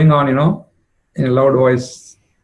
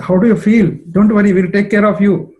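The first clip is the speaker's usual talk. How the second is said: high, louder fussing reassurance; anxious comforting; 7 semitones higher, 3 dB louder than usual. Acted. Jatila fusses over the bitten Radha, high and anxious. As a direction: soothe her in a worried rush.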